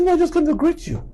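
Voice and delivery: high and fast; a lightly acted eager reply; eager reassurance. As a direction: quickly reassure the old auntie. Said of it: "I said".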